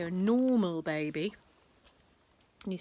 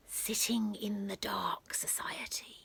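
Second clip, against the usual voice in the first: slow whisper; 5 dB quieter than usual. A narrator's reading. Whispered club name.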